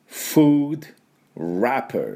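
"food wrapper" split into two slow words; English F and R in "food wrapper"; English pronunciation. Shown as a demonstration. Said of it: "英語でなんていうかっていうと".

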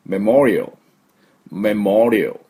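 メモリアル as "meh-MOR-ee-uhl"; メモリアル in English, swelling on the second syllable; correct English pronunciation. Shown as a demonstration. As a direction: stress "MOR" in メモリアル and end on a dark l. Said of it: "正しく発音すると".

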